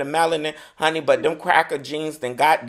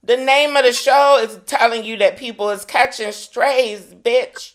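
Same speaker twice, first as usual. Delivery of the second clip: higher and louder, pressing the point; emphatic; 8 semitones higher, 5 dB louder than usual. Real, not acted. Cohost snapping back, louder and higher. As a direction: insist on it, voice up. Cohost defending his blunt remark.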